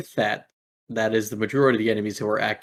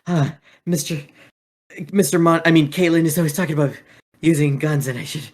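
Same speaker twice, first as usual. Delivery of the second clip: higher, fast rambling; nervous chatter, tripping over names; Tailbane; 5 semitones higher, 3 dB louder, faster than usual. Acted. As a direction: Tailbane rambling about guns, high and quick. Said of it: "And he goes".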